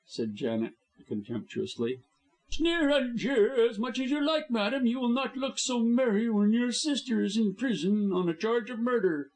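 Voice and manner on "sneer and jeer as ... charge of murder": high, threatening taunt; menacing high villain voice. A narrator's reading. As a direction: threaten the sister coldly. Blackmailer menacing.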